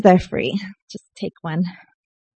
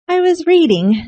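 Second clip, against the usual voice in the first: flustered gasp; 9 semitones higher, 6 dB louder than usual. Retold or imitated. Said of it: "I gasp and say".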